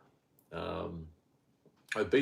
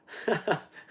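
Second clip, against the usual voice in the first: laughing; 8 semitones higher than usual. Real, not acted. Laughs after own impression.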